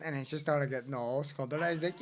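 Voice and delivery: usual speaking voice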